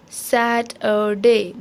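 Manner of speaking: सैटरडे slowly as "sat - er - day"; syllable division. Shown as a demonstration. Teacher blends the sounds back, सैटरडे slow and syllable by syllable. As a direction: say सैटरडे in three separated syllables. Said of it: "syllables division के ज़रिए".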